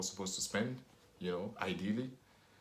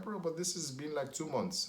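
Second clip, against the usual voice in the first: incredulous; 8 semitones higher than usual. Retold or imitated. Exasperated reply to friend.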